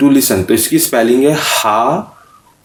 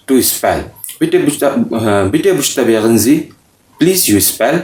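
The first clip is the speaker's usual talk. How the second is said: normal talking voice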